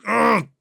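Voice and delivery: jolted by sudden pain mid-sentence; strangled cry as the attack hits; sudden pained grunt. Acted.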